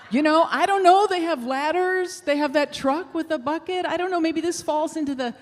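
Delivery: high, loud, laughing frantic rush of thoughts; half-laughing in disbelief at her own idea; desperate rambling. Retold or imitated. Mom at the park, wondering about calling firefighters, frantic and laughing. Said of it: "But I'm desperate".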